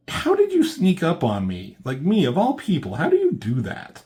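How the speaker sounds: high, loud astonished Batman; startled disbelief. Retold or imitated. Batman caught off guard by a sneak, high and loud. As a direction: act floored that anyone got past you.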